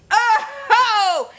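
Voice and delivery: loud, high laughing "Oh!"; startled yelp. Retold or imitated. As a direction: jump at the mouse darting past. Seeing the mouse dart.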